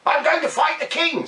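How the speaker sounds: high, bright-eyed little bird; squeaky bird full of resolve; blackbird voice. Acted. Blackbird announcing to the river, in a high bird voice.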